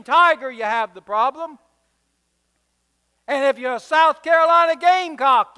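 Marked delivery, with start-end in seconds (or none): 3.3-5.5 loud, high preacher's punch; emphatic preaching. Real, not acted. Preacher calling out the rival team, emphatic and loud. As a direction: hit it high and hard from the pulpit. Preacher's list builds to local teams.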